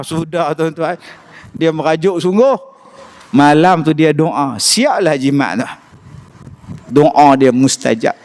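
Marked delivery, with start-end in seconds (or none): none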